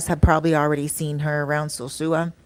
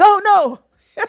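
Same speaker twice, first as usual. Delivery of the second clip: very high, laughing "oh no"; giggling high-pitched refusal; 6 dB louder than usual. Retold or imitated. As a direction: squeal it out through laughter. Man pulls away, narrator laughing.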